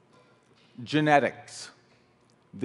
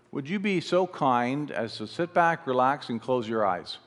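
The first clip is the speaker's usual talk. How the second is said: right in your ear. Acted. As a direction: talk them gently through picturing it. Leads a guided lemon visualization.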